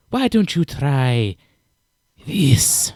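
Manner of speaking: slow, loud creature offer; sly lizard man. Acted. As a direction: draw it out as a creepy invitation. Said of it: "proffing a bowl and slowly walking towards you".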